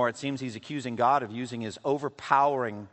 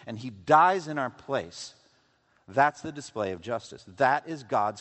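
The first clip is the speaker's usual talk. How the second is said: slow, louder pulpit thunder, each phrase driven home; preaching at full force; 4 semitones higher, 6 dB louder than usual. Acted. Preacher hammering the congregation with the cross, slow and loud.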